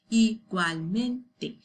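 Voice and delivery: slow, syllable by syllable; slow model word. Shown as a demonstration. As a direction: say it slowly for students to repeat. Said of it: "that's what you're going to repeat".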